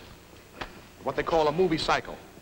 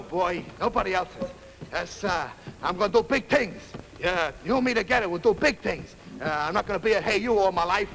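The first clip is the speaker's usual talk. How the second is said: put-on higher, lively tough-kid voice; cocky young street fighter; 5 semitones higher than usual. Young would-be fighter bragging to his girl and pals, cocky. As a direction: talk big like a neighborhood guy.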